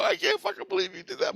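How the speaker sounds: very high, giddy squeal; retold disbelief. Storyteller to friend after the throw, high giddy disbelief. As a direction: squeak it out, thrilled and amazed. Retells amazed words to friend.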